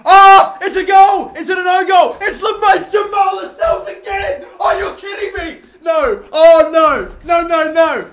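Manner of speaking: very loud, high-pitched celebration; ecstatic goal shout. Real, not acted. Commentator celebrating Lascelles scoring from a corner, ecstatic shouting. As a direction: scream it out in joyful disbelief.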